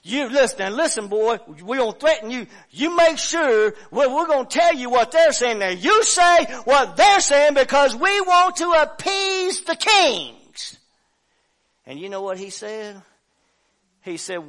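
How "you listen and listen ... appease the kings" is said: men threatening the prophet; threatening shout. Retold or imitated.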